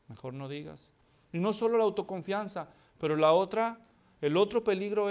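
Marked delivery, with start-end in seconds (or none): 0.1-0.9 much softer, low and muttered; quiet aside. Shown as a demonstration. Preacher warning the congregation, hushed advice. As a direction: drop "digas" down under your breath. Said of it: "Ay, mejor no digas".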